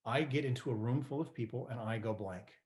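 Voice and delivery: plain reading voice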